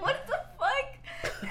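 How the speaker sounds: laughing incredulous what the fuck; stunned giggling disbelief; shocked disbelief. Retold or imitated. Retold shock at his pursed lips.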